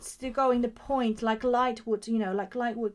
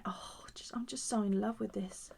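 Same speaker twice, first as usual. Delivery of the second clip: whisper; 9 dB quieter than usual. Real, not acted. Whispering to herself over the painting.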